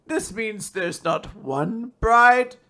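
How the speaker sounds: slow build to a loud, high reveal; hammed-up astonished father, drawing it out; mock dramatic shock. Acted dad's dramatic revelation.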